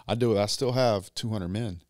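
ordinary delivery

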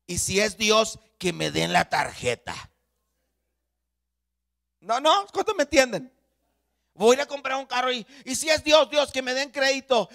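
loud, high-pitched pulpit exhortation; preacher raising the roof; full-force preaching. Acted. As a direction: drive it home to the congregation, loudly.

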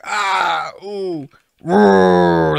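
mouthed game battle cries and roar; imitated rage effect. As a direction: voice a game's rage sound effect. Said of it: "that's the rage sound effect".

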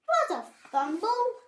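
high, loud cross dismissal; huffy. A narrator's reading. Babbitty shoos the bumblebee, loud huff. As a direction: shoo it away in a huff. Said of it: "he huffed".

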